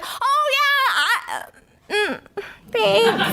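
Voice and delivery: high flustered stammer, voice cracking; caught-out squeak. Retold or imitated. A preteen caught by her mom in the basement stammers in a high squeak. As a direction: sputter like a kid caught red-handed. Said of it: "And I was like".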